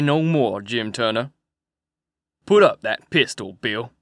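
a scornful outlaw gloating over a tied man; cold sneering villain. A narrator's reading. Jake Packard sneering at captive.

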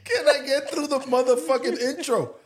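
high, laughing exasperation; exasperated laughing. Real, not acted. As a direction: plead while still laughing.

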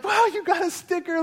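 very high, gushing retold praise; amazed praise. Dad hyping his daughter's sticker, exaggerated amazement.

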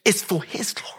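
hushed reverent whisper. Real, not acted. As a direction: let it fall to a whisper. Hushed aside after climax.

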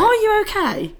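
squeaky high question; high mock voice. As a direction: pitch it way up, teasing.

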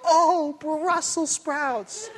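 high, very slow, tender; joyful reunion. Shown as a demonstration. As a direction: welcome home a lost dog. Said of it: "You could go".

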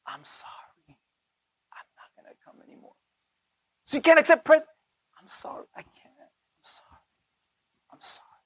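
whispered, gentle apology; soft apologetic whisper. Shown as a demonstration. Son whispers sorry to his family, gently. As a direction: breathe the sorry, barely voiced. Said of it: "Do you hear my tone?".

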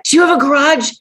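high, frantic pleading for the garage; panicked young man. Retold or imitated. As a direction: beg like he's terrified of being caught. Frightened young man begging.

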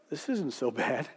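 a soft, low aside to himself; inner thought. A narrator's reading.

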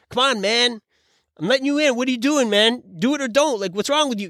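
high, exasperated yelling at another driver; annoyed driver. Retold or imitated. Driver scolding the merging car that won't go in, exasperated and high. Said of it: "then I get mad at him because I'm like".

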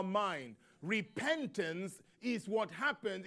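slow, forceful preaching building to a loud, high appeal; emphatic preaching. Acted. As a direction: press the call to change onto the congregation.

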